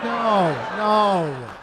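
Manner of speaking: loud flustered dad, denying fast; panicked father cutting his kids off. Retold or imitated. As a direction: shut it down like a caught dad.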